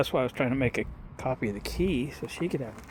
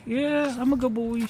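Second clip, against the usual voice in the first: much higher silly voice speaking as the dog; voicing the dog; 11 semitones higher than usual. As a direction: give the dog a cute high voice, "I'm a good boy".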